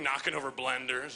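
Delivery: ordinary delivery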